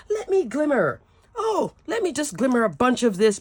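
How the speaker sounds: lightly voiced crafter craving glitter; mock eager crafter. Crafter voicing her own bad-day urge to glimmer, playfully.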